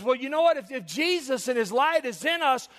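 put-on high, loud child's reasoning; little boy. A little boy questioning in Sunday school, high and loud. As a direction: pipe up loudly as a little boy. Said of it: "and the little boy says".

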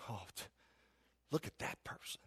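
hushed, snide whisper of a gossip. Retold or imitated. Preacher mimicking a scornful gossip, in a snide whisper. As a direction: lean in and sneer it under your breath. Mocking gossip aside in sermon.

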